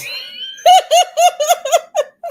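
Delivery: loud, high cackling laugh; big burst of laughter. Real, not acted. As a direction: explode into real laughter. Bursts out at mugshot shirt reveal.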